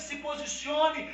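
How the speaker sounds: high, loud, slow and drawn out; slow roaring "se posicione"; shouted command. Acted. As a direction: hurl "se posicione" at them syllable by syllable. Climax repeat of command.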